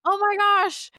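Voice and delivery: high, loud, gushing excitement; mock the Americans' delighted rave; gushing praise. Americans gushing over her humor, high excited mock praise. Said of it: "everyone was like".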